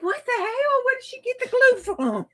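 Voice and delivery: high, disbelieving retold reaction; what-the-hell disbelief; incredulous. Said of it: "I'm like".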